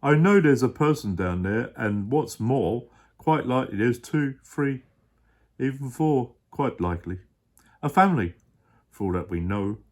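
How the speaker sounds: low, loud gentle giant pleading; a big kind elephant voice, sure of himself; deep Horton voice, earnest. Acted. Dad as Horton explaining to his child.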